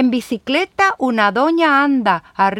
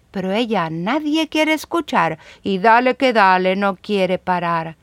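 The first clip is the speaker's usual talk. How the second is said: ordinary delivery, nothing marked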